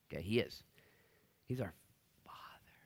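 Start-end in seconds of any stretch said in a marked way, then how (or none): 2.3-2.7 soft whisper; whispered Father. Acted. Preacher telling church God is Father, tender whisper. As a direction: breathe the word gently.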